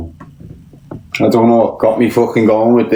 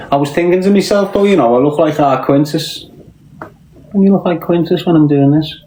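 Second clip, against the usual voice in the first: raised excited chatter; 6 semitones higher than usual. Real, not acted. High-pitched excited chatter.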